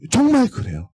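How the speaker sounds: ordinary delivery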